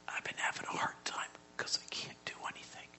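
very soft, voiceless sickbed confession; Mike's weak whisper. Retold or imitated. Dying friend confides in the pastor at his bedside, weak whisper. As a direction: whisper it with little strength. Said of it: "he talks like this".

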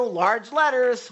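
"large letters" slowed and punched; drawn-out emphasis. Retold or imitated.

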